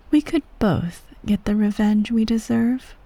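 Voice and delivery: plain reading voice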